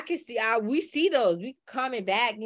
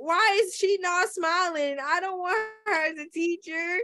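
high-pitched, complaining child imitation; little kid; 8 semitones higher than usual. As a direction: play a kid objecting to the new teacher. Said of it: "where the little kid was like".

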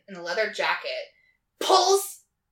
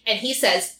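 ordinary talk, nothing marked

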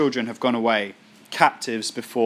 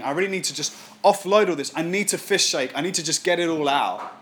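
ordinary talk

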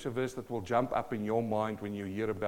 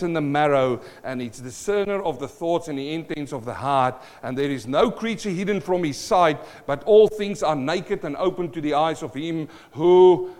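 raised, forceful pulpit reading; scripture declaimed; 6 semitones higher, 9 dB louder than usual. Real, not acted. Pastor reads Hebrews to the congregation, forceful declamation. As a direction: proclaim the verse with rising weight.

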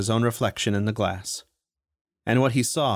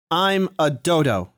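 higher, slow defiant yell; 7 semitones higher, 4 dB louder, slower than usual. Acted. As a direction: stand your ground and shout. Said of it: "he yelled".

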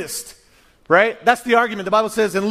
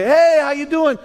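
friendly host; 7 semitones higher, 3 dB louder than usual. Retold or imitated. Host greeting guest.

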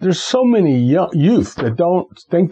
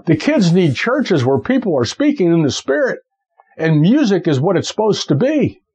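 usual speaking voice

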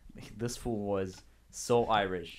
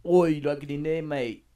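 put-on accidental Australian accent; 3 semitones higher, 6 dB louder than usual. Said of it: "That's Australian".

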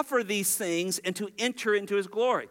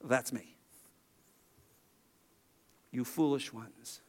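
low, hushed voice of Jesus; Jesus speaking; 9 semitones lower, 7 dB quieter than usual. Retold or imitated. Preacher voicing Jesus softly to the two disciples. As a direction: drop low and quiet, gently rebuking. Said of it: "And Jesus goes".

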